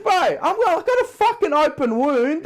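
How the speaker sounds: exasperated bro yelling at the wait; mock outraged yell. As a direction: rant it like you're fed up. Retold outburst at hospital wait.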